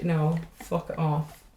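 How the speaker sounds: ordinary talk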